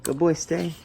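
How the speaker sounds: high sing-song dog praise; talking to a dog. Real, not acted. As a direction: coax the dog sweetly. Praising dog, higher pitch.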